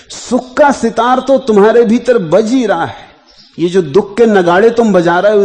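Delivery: loud oratorical surge; impassioned preaching. Real, not acted. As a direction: proclaim it high and loud to the listeners. Discourse rising to full force.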